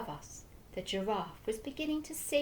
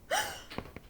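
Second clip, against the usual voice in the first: gasp. A narrator's reading. Shock at the pistol.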